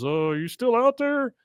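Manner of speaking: high, uneasy character call; Hank. Retold or imitated. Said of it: "Hank goes into his bedroom bathroom".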